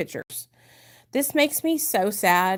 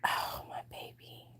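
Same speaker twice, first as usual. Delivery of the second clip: soft breathless whisper; tender whisper; 17 dB quieter than usual. Real, not acted. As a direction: whisper it, moved by the memory. Watching newborn son video.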